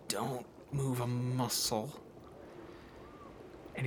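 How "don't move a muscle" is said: softer, slow hushed warning. Acted. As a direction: still them with a slow hushed order. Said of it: "He's like".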